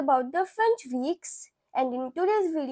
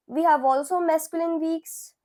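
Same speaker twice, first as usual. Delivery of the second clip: ordinary delivery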